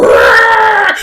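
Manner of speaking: dinosaur roar. Pretend. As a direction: roar like a kid playing dinosaur. Imitating a dinosaur.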